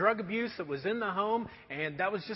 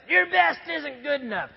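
foreman's retort; 4 semitones higher, 4 dB louder than usual. Retold or imitated. Foreman snapping back.

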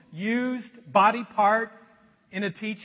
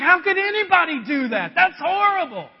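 louder, higher indignant outburst; fired-up outrage; 7 semitones higher, 9 dB louder than usual. Retold or imitated. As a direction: blurt it out, scandalised. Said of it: "David gets all fired up".

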